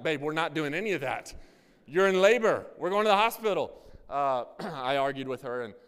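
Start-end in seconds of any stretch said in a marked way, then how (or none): 0.0-3.8 urgent insisting. Retold or imitated. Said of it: "I'm like".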